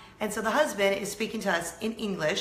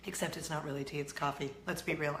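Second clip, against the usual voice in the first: usual speaking voice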